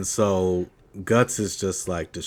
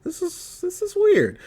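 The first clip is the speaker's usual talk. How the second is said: high squeaky voice. Retold or imitated. Own reaction retold.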